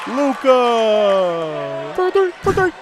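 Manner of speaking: high shouted hype call; arena announcer. Retold or imitated. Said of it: "You're always good with the impressions".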